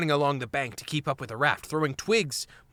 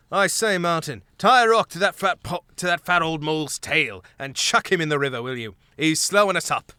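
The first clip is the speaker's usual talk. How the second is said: lightly voiced, haughty mouse; a touch of lordly scorn; haughty Gonf; 3 semitones higher, 6 dB louder than usual. A narrator's reading. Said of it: "Gonf called back haughtily".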